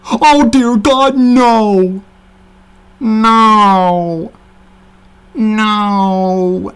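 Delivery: slow, loud mock despair over ponies; anguished rant. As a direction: wail it like a hammy critic. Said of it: "he was like".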